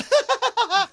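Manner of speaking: short burst of high cackling; madman laugh. Retold or imitated. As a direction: cackle helplessly at the memory. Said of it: "and I would just go".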